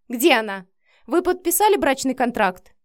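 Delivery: loud, agitated questioning; shrieking demand. A narrator's reading. Mother demanding to see the new wife, yelling. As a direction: fire off loud, outraged questions. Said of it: "завопила женщина".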